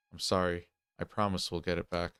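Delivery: ordinary delivery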